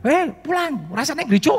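high, shouting Betawi dad calling his son home; angry Betawi father. Retold or imitated. Angry father calls his son away from church, in Betawi. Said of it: "Pokoknya pakai bahasa Betawi".

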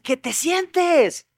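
orden enojada, más aguda y muy lenta; papá enojado. Retold or imitated. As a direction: marca cada sílaba como padre harto. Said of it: "Finalmente el papá se enoja y dice". (papá enojado ordena al niño sentarse, lento y agudo)

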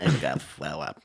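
low-voiced mimicry of an acquaintance; Betty. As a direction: drop the pitch into a character impression. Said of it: "do a Betty impression".

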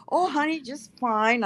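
sweet reply to son. Retold or imitated. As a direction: coo it to your son, slowly. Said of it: "And I said".

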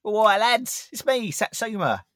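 high, chummy blokey greeting; big friendly lad hello; cheeky lad voice. Pretend. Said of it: "Satsuma's like".

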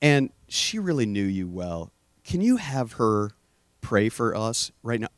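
low, soft, halting plea; retold tearful prayer. Begging God to tell his sister-in-law, softly tearful. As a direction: softly relive a crushed, pleading prayer. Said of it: "I'm just crying".

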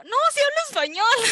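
high, loud, eager "yes I speak Spanish"; excited squeal. Retold or imitated. Narrator answering the Peruvian girl, high excited relief.

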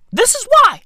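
high, loud exasperated cry; fed-up fan crying out; exasperated rant. Real, not acted. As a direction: throw your hands up, high and loud. Ranting about Arsenal.

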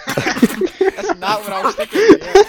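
laughing hard through the words; cracking up mid-sentence. Real, not acted. Laughing at a dirty joke.

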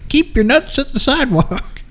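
put-on high quavery old lady; a stern granny scolding; grandma voice. Continues grandma voice bit.

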